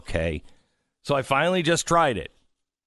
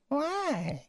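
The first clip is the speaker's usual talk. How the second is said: high put-on nagging wife voice; mock wife; 12 semitones higher, 6 dB quieter than usual. Host imitating his wife nagging him, in a high put-on voice. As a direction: squeak it like an insistent wife. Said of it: "She's like".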